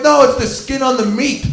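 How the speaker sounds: high-pitched insistent protest; retold defensive denial. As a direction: act out a desperate cover-up. Retold desperate denial to classmates.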